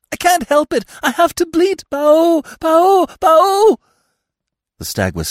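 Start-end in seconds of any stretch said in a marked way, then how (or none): 0.1-3.8 very high, loud deer bleat; loud, piercingly high animal cries; hysterical bleating. A narrator's reading. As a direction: wail shrilly like a panicked fawn. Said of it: "she went on".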